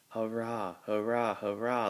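plain reading voice

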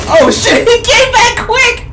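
very high, loud yell into laughter; panicked high squeal with laughter; startled shriek. Real, not acted. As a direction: scream in shock, then crack up. Opponent suddenly returns.

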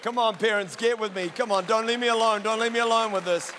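rally the parents to your side; calling out. Real, not acted. Pastor to the parents in the room, loud playful plea.

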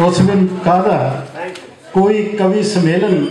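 lilting mushaira verse, phrase by phrase; poem recitation. Acted. Poet praising his friend to the gathering, lilting recitation. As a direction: repeat the opening line, settle onto the refrain.

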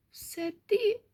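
soft, very high mouse voice; tiny high-pitched murmur; barely audible squeak. A narrator's reading. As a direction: squeak it faintly, sheepish little mice. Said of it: "ледве чутно пропищали мишенята".

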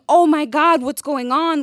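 loud, high alarmed cry; panicked rush to help a crying friend; crisis mode. Retold or imitated. Finding her roommate sobbing, a loud panicked cry. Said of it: "I was in crisis mode".

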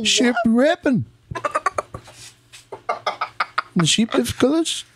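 high-pitched put-on Irish accent. Shown as a demonstration. Doing the assigned Irish accent round the table, comic Irish accent.

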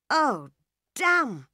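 a light startled "Oh, damn" up high; mild exclaim over spilled apples; woman's exclamation. A narrator's reading. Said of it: "she said".

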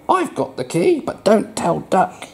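high, sneaky little frog voice; squeaky Froggy voice. Acted. Parent voicing Froggy to a child, high and sneaky. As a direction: whisper it like a cheeky secret keeper.

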